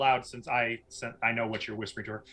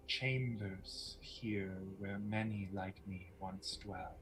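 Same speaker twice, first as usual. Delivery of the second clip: slow, hushed, measured menace; softly spoken mysterious woman; mysterious villainess; 4 semitones lower, 16 dB quieter, slower than usual. Acted. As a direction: speak softly and slowly, like a sly enchantress. Game master voices Lucretia.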